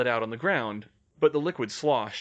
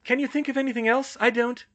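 high, quick and loud, cut short; high female character voice, hurried; Hermione's voice; 12 semitones higher, 5 dB louder than usual. A narrator's reading. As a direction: rush it high, then break off abruptly. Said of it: "Her voice cut off abruptly".

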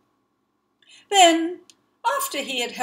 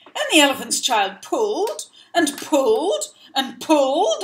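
straining effort; 4 semitones higher, 7 dB louder than usual. A narrator's reading. Tug of war.

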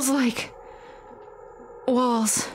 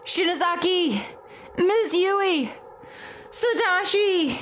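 calling out; 7 semitones higher, slower than usual. Acted. Calling out in the dark.

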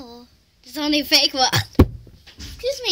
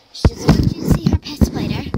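loud; 11 dB louder, faster than usual. Real, not acted. Loud fast outburst about sister.

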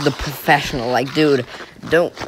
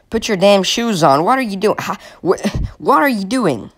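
ordinary talk, nothing marked

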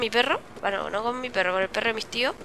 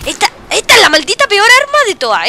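loud, high-pitched outraged complaint; exasperated rant; 7 semitones higher, 10 dB louder than usual. Real, not acted. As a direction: curse the useless weapon loudly. Rants about hated weapon.

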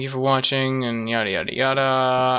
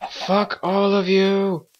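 angry crying; 6 semitones higher, slower than usual. Real, not acted. Attacked, lost in the dark.

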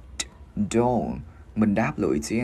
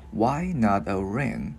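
"Why not a ring" drawn out slowly, word by word; slow, careful model of the question; slowed-down demonstration; slower than usual. Shown as a demonstration. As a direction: stretch each word for learners. Teacher slows the line for learners.

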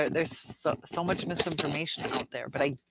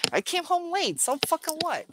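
high, scoffing "so fucking what"; a pitched-up mocking brush-off; mocking defiant narcissist; 12 semitones higher than usual. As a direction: sneer it like a careless partner. Narcissist voiced in scornful quote.

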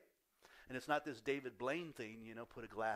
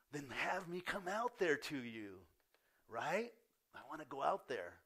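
raised, louder, eager voice; acted excitement; 4 semitones higher, 6 dB louder than usual. Peter asking to come out on the water, excited and loud. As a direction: push it out eagerly, pitched up. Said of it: "there's an excitement there on his part".

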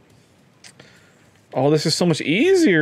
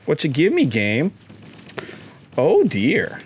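ordinary talk

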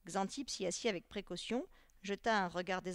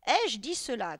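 loud, higher indignant retort; angry shout; 4 semitones higher, 10 dB louder than usual. A narrator's reading. Teacher snaps at a timid pupil, shouting in anger. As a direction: shout the question back angrily. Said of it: "cria Xanthippe en devenant rouge de colère".

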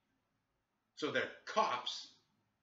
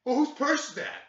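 loud, high-pitched accusing imitation; hammed-up cop demand; mock interrogating cop; 13 semitones higher, 12 dB louder than usual. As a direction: mimic a suspicious officer pouncing. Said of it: "kept basically interrogating me".

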